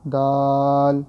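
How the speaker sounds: دال held long and loud; drawn-out letter name. Shown as a demonstration. Teacher spells for students, long loud دال. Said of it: "without spelling پڑھنا ہے".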